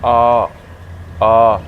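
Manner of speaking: आ held long and open; long aa sound. Shown as a demonstration. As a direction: sustain आ as "aaa". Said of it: "तो ये long".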